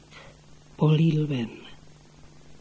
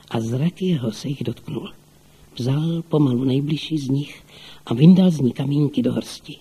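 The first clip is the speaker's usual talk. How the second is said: ordinary delivery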